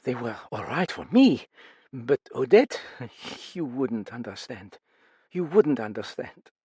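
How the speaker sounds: words pushed out through pain; anguished mother. A narrator's reading. Mother breaking off as she tells of her daughter, anguished.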